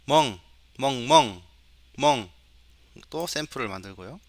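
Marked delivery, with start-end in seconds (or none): 0.1-2.3 spoken, evenly spaced dog woofs, a bit loud; dog bark imitation. Shown as a demonstration. Teacher recording dog samples for the computer, imitated barking. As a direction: imitate a dog with short, separate barks. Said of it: "이런 소리는 강아지야".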